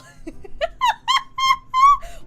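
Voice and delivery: shrieking laugh, very high and loud. Real, not acted. A streamer cracking up at a silly name, shrieking laugh.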